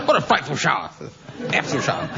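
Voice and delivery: lightly voiced plummy cad exclaiming; a hint of the old film toff, amused; Terry Thomas impression. Panellist imitating an old film toff, lightly voiced. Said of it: "Terry Thomas films".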